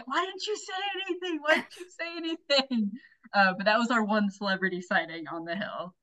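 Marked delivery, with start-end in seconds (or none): 0.0-2.9 Maddie. Retold or imitated. Imitating a friend yelling about the missed sighting, squeaky mock yell. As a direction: imitate a friend's wailing complaint in a much higher voice. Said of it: "Maddie starts yelling".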